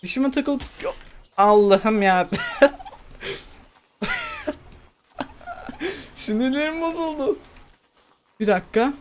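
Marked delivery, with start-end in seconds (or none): 1.4-7.4 exasperated laughter. Real, not acted. Frustrated, laughing at himself.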